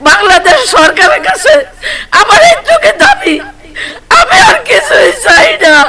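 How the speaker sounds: hit hard crying out. Real, not acted. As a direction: wail the demand through tears. Mother sobbing demands to government.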